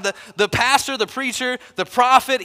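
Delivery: ordinary talk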